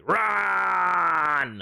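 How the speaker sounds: high, drawn-out mock shout; retreat-call impression. Streamer imitating his retreat call, long high mock shout. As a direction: yell it long like a game character's retreat. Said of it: "I think his retreat is like".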